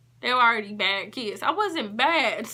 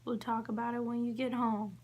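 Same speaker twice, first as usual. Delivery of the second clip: soothing mom; 11 dB quieter than usual. Retold or imitated. Mother soothing on phone.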